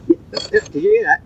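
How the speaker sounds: sudden high burst of excitement; thrilled the dog almost barked; excited. Real, not acted. Owner to dog on tape, excited high burst.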